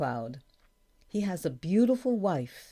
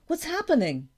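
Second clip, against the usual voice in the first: high, louder worried question; alarmed question; 11 semitones higher, 5 dB louder than usual. A narrator's reading. Daughter running out to her father, alarmed. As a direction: ask it urgently, pitched well up.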